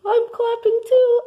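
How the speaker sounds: squeaky high-pitched character voice. Acted. Same co-host line repeated.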